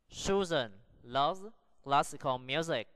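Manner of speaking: slow, spaced-out reading with pauses between phrases; slow model sentence. Shown as a demonstration. Teacher models sentence number one for practice, slow and deliberate. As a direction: carefully sound out each word for learners. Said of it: "Practice the sentences".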